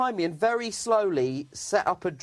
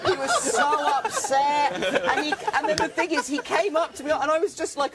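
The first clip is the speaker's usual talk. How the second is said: laughing; 10 semitones higher than usual. Real, not acted. Speaker still laughing through story.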